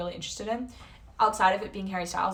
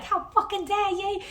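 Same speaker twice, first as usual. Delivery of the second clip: high, loud mock outrage; indignant high-pitched imitation; outraged performer; 12 semitones higher, 5 dB louder than usual. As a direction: burst out in exaggerated offended protest. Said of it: "He's gonna come out and be like".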